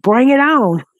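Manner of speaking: mock-brave taunt; defiant challenge. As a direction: throw down the gauntlet. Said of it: "Aussie said".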